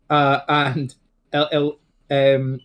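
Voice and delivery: ordinary delivery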